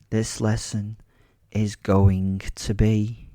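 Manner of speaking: slow, deliberate threat; slow menace. Acted. Attacker promising two boys a lesson, slow menace. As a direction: spell out the threat with weighty pauses.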